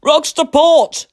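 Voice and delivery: slow, high, loud warning cry; lookout's cry. A narrator's reading. Lookout warns the helmsman of rocks, slow loud cry. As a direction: sing it out from the masthead. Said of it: "cried the lookout".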